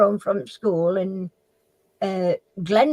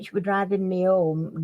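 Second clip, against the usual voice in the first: ordinary delivery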